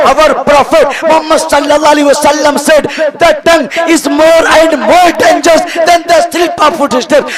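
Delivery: slow, ringing proclamation in English; proclaimed hadith. Acted. Preacher quoting the Prophet to the crowd, slow loud proclamation. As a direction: declaim the quote slowly and loudly to the hall.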